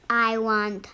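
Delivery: usual speaking voice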